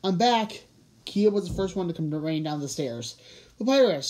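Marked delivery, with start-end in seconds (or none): 0.0-0.6 raised, higher-pitched homecoming call; mild holler on arriving. A narrator's reading. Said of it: "he called". 3.6-4.1 higher, louder exclamation of a name; raised, excited greeting; delighted cry. A narrator's reading. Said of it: "she cried when she saw".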